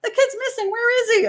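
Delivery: high-pitched, hammed-up alarm over a missing kid; mock panicked parent. Parent pretending to discover the child is missing, mock panic. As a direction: fake the shock, way up high. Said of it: "wake up in the morning and go".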